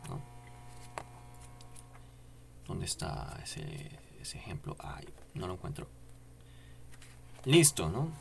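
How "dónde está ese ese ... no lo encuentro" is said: whisper. Real, not acted. Searching for the book example.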